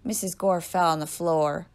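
ordinary delivery

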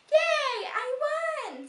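high, loud victory squeal; excited cheer. Acted. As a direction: squeal with joy at winning. Molly cheering her win.

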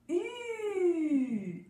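U, high and rounded, like urging a horse on; a long high U to make the horse go; vowel U to move a horse. Shown as a demonstration. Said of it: "elle fait". (U aigu et arrondi pour faire avancer le cheval)

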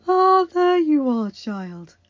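high, loud, slow delighted cry; joyful old woman's exclamation; exclaimed. Acted. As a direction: greet the flower with joy. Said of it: "Agatha exclaimed".

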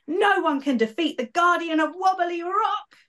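high, jubilant battle cry; high triumphant boast of a cocky guardian. Acted. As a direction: crow it like a show-off hero. Betty leaps up triumphant.

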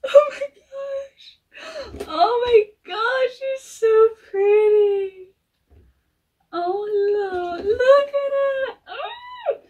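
high, loud, drawn-out gushing; gushing with delight. Real, not acted. Collector unwrapping her long-awaited horse, gushing high and loud. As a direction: overcome with joy, squealing slowly. First sight of the doll.

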